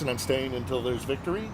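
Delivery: ordinary talk, nothing marked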